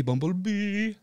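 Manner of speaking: Bumblebee jumped up high in pitch. Shown as a demonstration. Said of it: "like that".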